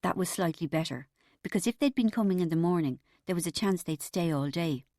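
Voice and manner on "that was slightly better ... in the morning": ordinary delivery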